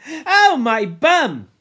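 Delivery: slow, high, loud moan; rueful yelp. Acted. Robert rubbing his rump after the game, rueful wail. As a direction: wail it, nursing a sore behind. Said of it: "he rubbed his rump ruefully".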